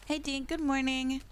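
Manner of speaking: normal talking voice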